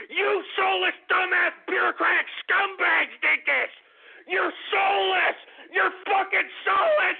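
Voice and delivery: high-pitched tirade rising to a yell; enraged, straining accusation; furious shouting. Real, not acted. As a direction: curse them out with hatred. Cursing bureaucrats directly, shouting.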